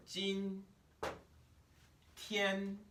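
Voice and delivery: "今" and "天" both held high and level; high flat first tones. Shown as a demonstration. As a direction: keep "今 天" high and flat, no waver. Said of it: "they start here and go over".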